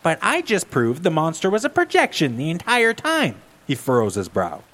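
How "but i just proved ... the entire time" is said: put-on higher, know-it-all girl; Velma voice. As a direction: insist you solved it.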